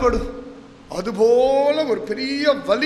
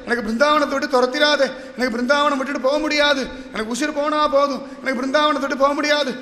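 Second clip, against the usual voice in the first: wailing plea; 3 semitones higher than usual. Acted. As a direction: beg desperately, hammering each repeated plea. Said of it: "கதறி எழுந்து ஓடுறா". (கதறி மன்றாடும் குரல்)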